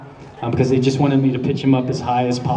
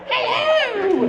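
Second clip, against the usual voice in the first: much higher falsetto hello, hammed up; Monty Python old lady. Shown as a demonstration. Voice actor redoing his girl voice as a Python character, falsetto. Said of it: "really terrible Monty Python character".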